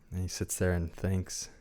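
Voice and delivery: normal talking voice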